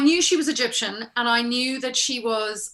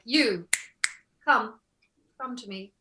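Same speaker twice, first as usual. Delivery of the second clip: regal goddess. Acted. Actress replaying her audition as a queen, regal and unhurried. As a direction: command with pauses, sinking to a hush. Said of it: "sat back in my chair and I was very much like".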